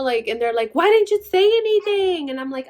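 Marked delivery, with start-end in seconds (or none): 0.7-2.3 high loud alarmed question; worried relatives demanding answers; alarmed family. Retold or imitated. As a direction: sound shocked they weren't told.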